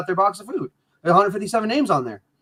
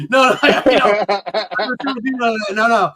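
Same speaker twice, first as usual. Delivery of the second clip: laughing it off while backpedaling; 2 semitones higher, 4 dB louder than usual. Real, not acted. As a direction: chuckle through the denial.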